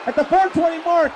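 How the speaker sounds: high, urgent submission call; excited play-by-play. Real, not acted. Commentator calling a sharpshooter submission attempt, urgent. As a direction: keep the pitch up as the hold drags on.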